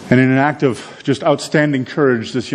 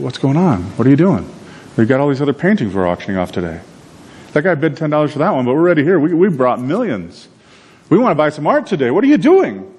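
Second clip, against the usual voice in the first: higher, rising into an indignant near-shout; outraged buyers; 6 semitones higher than usual. Retold or imitated. Art buyers confronting the auctioneer, rising to high indignant shout. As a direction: demand answers, exasperated.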